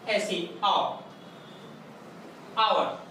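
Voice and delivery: आवर with a silent h, open aa then var; आवर, shown twice, first sound a plain aa; hour said as aavar. Shown as a demonstration. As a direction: say आवर slowly, no h sound at all. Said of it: "इसको आवर पढ़ते हैं".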